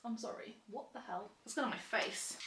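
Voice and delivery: ordinary talk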